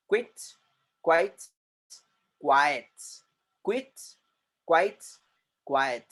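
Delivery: क्विट short, क्वाइट with a long ai; contrasting word series. Shown as a demonstration. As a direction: set the words side by side, each clipped. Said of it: "गौर से सुनिए please".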